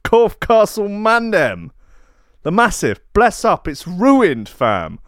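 big up the castle crew, London street style; roadman slang voice. Pretend. Shouting out castle fans as a London roadman. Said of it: "The Natty T Roadman".

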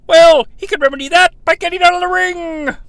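ordinary talk

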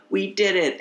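mock triumphant we-made-it cry; triumphant cheer. Heroes triumph at City Hall.

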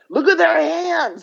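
high, loud, drawn-out exclamation; imitated amazement. Friends spotting the brothers' matching hands, high, slow and amazed. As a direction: act out astonished friends pointing it out.

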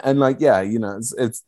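normal talking voice

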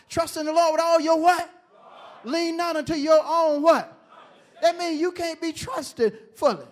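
high, loud preacher climax; preaching at full force. Acted. Pastor preaching to his congregation, loud and high. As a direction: drive it home, call and response.

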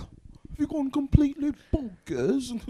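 hammed-up British accent, pitch leaping up; attempted British accent. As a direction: do your best mock-British accent. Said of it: "That's the best I can".

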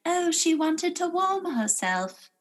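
high, slow, clueless voice; oblivious townsperson. Retold or imitated. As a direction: play a dim passer-by, airy and slow. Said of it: "just being like".

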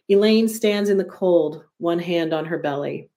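ordinary delivery, nothing marked

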